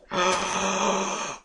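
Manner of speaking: long high gasp of awe; drawn-out gasp; amazed gasp. Real, not acted. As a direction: draw in a big astonished breath. Amazed by flowers.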